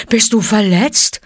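anxious, caring question; concerned bear. A narrator's reading. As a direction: ask anxiously as a fond bear. Baloo anxiously asks Mowgli.

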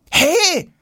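high loud indignant hey; protesting. A narrator's reading. Boy protesting at the satchel blocking him. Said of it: "protestiert Jonas".

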